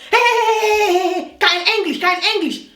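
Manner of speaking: imitating a rude receptionist shooing people off; harsh brutal rebuke. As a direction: bark it at them like a rude clerk. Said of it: "in a very harsh and, you know, brutal way".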